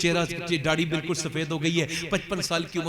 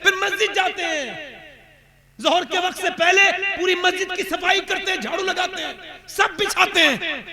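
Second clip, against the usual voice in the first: high, loud impassioned preaching; preaching at full force; 13 semitones higher, 7 dB louder than usual. Real, not acted. Preacher rousing the congregation about Umar's humility, loud and high. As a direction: build to a ringing sermon climax.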